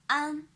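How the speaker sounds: "an" in first tone. Shown as a demonstration. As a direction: sing "an" high and flat. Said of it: "the first tone".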